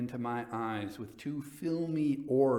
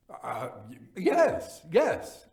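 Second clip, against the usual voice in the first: flustered stammer; 4 semitones higher, 7 dB louder, slower than usual. A narrator's reading. Narrator stammers assent.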